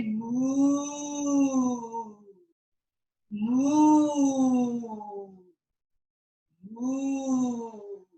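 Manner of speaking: long, high, sustained moo; cow mooing. Pretend. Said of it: "Look up and say".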